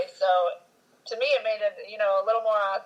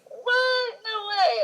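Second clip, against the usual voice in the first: disbelief; much slower than usual. Retold or imitated. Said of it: "I was like".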